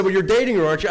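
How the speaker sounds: imitated detective, loud and high; accusing questioning. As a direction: push the accusation as a leading question. Detective pressing him in retold questioning.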